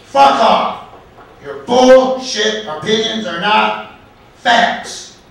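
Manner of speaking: loud, high indignant blast; emphatic, furious rebuttal; angry outburst. Acted. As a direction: spit it out slowly, word by word, at high volume. Acted angry opening of the story.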